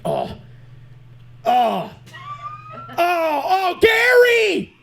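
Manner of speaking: hammed-up moans climbing to a shouted name; mock climax cry.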